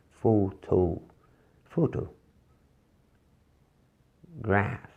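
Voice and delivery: soft classroom demo; soft model word. Shown as a demonstration. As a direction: murmur Photo quietly for the class to copy. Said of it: "Leiam baixinho".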